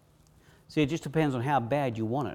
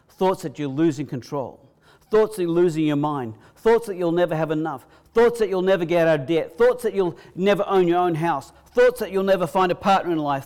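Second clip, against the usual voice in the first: louder and louder list, each thoughts hammered; building preaching; 3 semitones higher, 6 dB louder than usual. Acted. Preacher warning the congregation about fearful thoughts, building. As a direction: drive the list home, gathering force.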